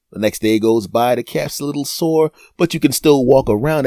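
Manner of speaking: ordinary talk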